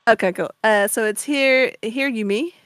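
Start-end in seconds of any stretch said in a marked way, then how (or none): none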